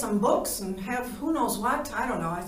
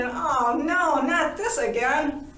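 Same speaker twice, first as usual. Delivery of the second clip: high, loud, exasperated groan of words; mock dismay; 6 semitones higher, 4 dB louder, slower than usual. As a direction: play up the dread, drawing it out slowly. Retold thought on finding self underwater.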